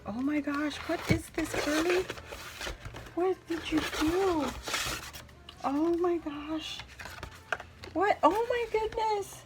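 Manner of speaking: high-pitched, delighted disbelief; real surprise, voice way up high; amazed. Real, not acted. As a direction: squeal in astonishment at a gift. Amazed by the gift.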